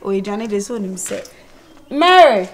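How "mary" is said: loud, higher call of a name. Acted. Summoning Mary from elsewhere, calling out loudly. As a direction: shout for someone in another room.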